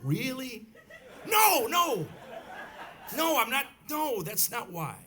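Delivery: high, loud, incredulous denial; retold dad loudly insisting otherwise; emphatic protest. Acted protest to daughter's doubt.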